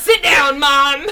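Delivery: loud, high retold order to Mom; exasperated mock yell. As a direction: call out as if scolding your mother. Re-enacts yelling at mom.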